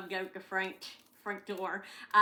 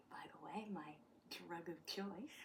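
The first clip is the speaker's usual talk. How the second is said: whispered joke; 3 semitones lower, 16 dB quieter than usual. Real, not acted. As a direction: whisper it like a guilty secret. Whispered joke about hot chocolate.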